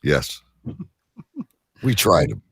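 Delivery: usual speaking voice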